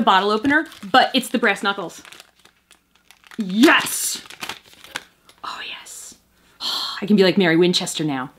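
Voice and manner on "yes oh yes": hushed whisper of glee; delighted whisper. Real, not acted. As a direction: whisper it with satisfaction.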